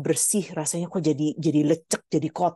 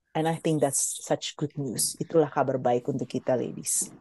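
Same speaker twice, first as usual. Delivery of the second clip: sincere closing; 6 semitones lower, 4 dB quieter than usual. Real, not acted. Closing message, softening down.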